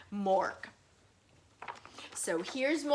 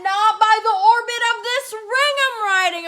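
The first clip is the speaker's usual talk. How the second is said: higher, louder, stubborn; defiant refusal; 9 semitones higher, 7 dB louder than usual. Acted. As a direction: refuse boldly. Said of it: "Gork and Bork said".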